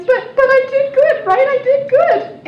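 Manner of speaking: high, loud, half-crying plea for approval; mock whimper. Rescuer pleading with the woman she helped, mock crying. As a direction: wail it like a hurt child wanting praise. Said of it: "And I'm like".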